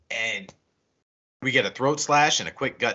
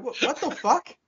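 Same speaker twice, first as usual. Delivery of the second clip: higher baffled squeak; mock disbelief; 12 semitones higher than usual. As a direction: act stunned, pitched up. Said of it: "I'm like".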